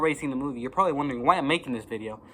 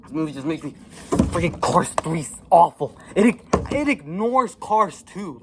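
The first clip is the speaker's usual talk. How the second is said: getting angry; 3 semitones higher, 6 dB louder than usual. Real, not acted. Reviewer apologizing then exploding in anger. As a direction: let the real anger burst out. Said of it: "I'm sorry I'm getting so angry".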